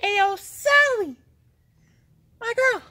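very high, drawn-out holler; calling out. Real, not acted. Vlogger summoning Sally in the tunnel, high hollered call. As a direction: holler it high and slow into the distance.

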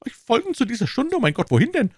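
high, fearful rising question; frightened stammer. Acted. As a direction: panic at being taken away. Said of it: "stammelte Bonacieux".

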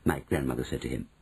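ordinary delivery

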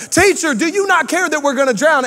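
high panicked cry on "Teacher"; panicked disciples. Retold or imitated. Disciples crying to Jesus in the storm, high and panicked. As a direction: plead like men about to sink. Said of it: "They were calling him teacher".

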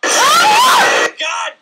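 loud, drawn-out curse; furious shout. Real, not acted. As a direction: yell it out at the jump scare. Jump scare, screaming.

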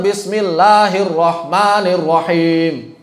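slow basmalah in a local Indonesian accent. Shown as a demonstration. Teacher shows how not to recite basmalah, drawn-out Indonesian accent. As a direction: stretch each syllable with an Indonesian accent. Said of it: "baca basmalahnya bukan".

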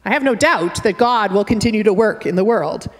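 ordinary talk, nothing marked

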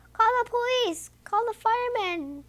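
restrained storybook shout; mild shout. A narrator's reading. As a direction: call out urgently in a high voice. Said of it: "shouts the farmer".

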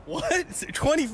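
high-pitched, incredulous question; squeaky, disbelieving surprise; astonished. Real, not acted. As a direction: react in delighted disbelief. Reacts to a very low guess.

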